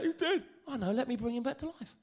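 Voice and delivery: high, hammed-up wail; comically overdone distress; mock crying. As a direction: pretend to bawl in panic. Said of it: "He didn't cry his eyes out".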